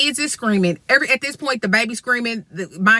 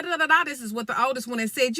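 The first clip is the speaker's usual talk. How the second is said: ordinary talk, nothing marked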